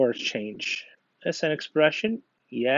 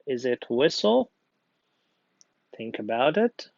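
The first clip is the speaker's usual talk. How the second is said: normal talking voice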